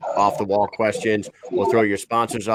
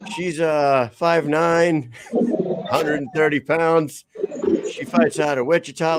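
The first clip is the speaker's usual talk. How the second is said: ring announcer; 6 semitones higher, slower than usual. Retold or imitated. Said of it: "like normal, uh, normal ring announcers would have done".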